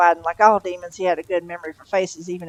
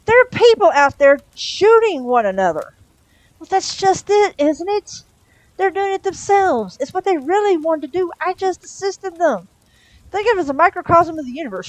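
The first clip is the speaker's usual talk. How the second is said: high, louder demon voice, pleased with himself; Crowley's voice; 11 semitones higher, 7 dB louder than usual. A narrator's reading. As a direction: sell the chaos with a smug high voice.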